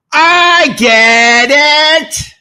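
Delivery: high, slow, loud put-on voice; a brief goofy character bit, loud and slow; silly put-on voice. Odd voice, then wrong show.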